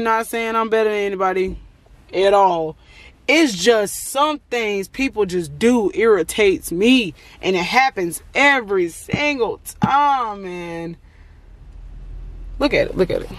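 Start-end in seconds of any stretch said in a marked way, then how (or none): 3.3-11.0 slow, word-by-word exasperated venting; slowed right down for emphatic annoyance; exasperated emphasis. Real, not acted. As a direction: drag out each word, fed up. Venting about what irritates her.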